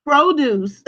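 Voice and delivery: Produce said slowly with stress on PRO, long o; noun PRO-duce. Shown as a demonstration. Teacher showing students the noun Produce, stressed PRO-duce. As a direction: Produce as in fresh groceries, PRO-duce, drawn out with a laugh. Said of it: "Do not confuse this with produce".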